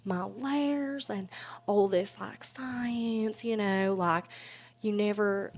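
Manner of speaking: slow, sugary Southern drawl; Southern accent. Shown as a demonstration. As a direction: stretch the vowels like a Southern belle. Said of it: "my character talked in a Southern accent".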